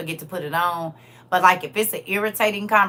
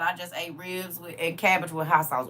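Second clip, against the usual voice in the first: ordinary talk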